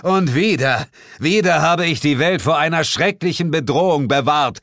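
high, grandiose proclamation; hammed-up heroic yell; loud shouting. A narrator's reading. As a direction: declare yourself the world's savior. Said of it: "schrie ich laut".

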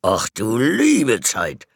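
father exclaiming. A narrator's reading. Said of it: "rief Ellis Vater".

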